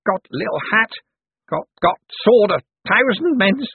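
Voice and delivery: high, halting, stumbling speech; delirious babble. A narrator's reading. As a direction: stammer it out, out of your wits. Cosmo babbles deliriously before drawing sword.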